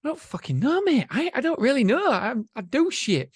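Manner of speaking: high-pitched flustered mock answer; a high, squeaky put-on panicked reply; mock flustered self. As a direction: pitch way up like a flustered kid caught out. Mock exasperated self-quote.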